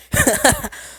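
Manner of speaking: high amused laugh. Real, not acted. Storyteller reacting to the aunt reveal, amused laugh. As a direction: laugh at the silly twist.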